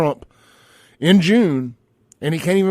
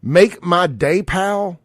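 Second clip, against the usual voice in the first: slow, higher mock echo of the tough-guy line; mocking Biden quote; 5 semitones higher, 4 dB louder, much slower than usual. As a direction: repeat his line back to ridicule it. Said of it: "And did he say".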